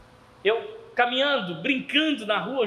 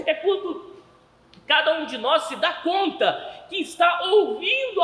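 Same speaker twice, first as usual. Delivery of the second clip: loud, emphatic sermon build-up; impassioned preaching; 5 semitones higher, 4 dB louder than usual. Acted. Preacher urging the congregation to heed God's call, impassioned. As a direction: preach it with force, hammering key words.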